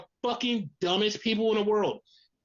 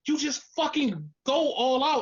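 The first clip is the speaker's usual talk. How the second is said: ordinary delivery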